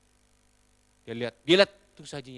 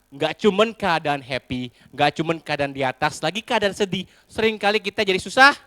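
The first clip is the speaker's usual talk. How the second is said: ordinary talk, nothing marked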